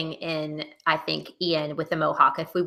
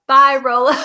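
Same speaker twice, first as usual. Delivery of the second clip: a brighter, louder little wave-off goodbye; mock goodbye; 5 semitones higher, 7 dB louder than usual. The imagined showrunners bidding the dog farewell, mock cheery.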